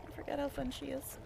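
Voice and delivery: ordinary talk, nothing marked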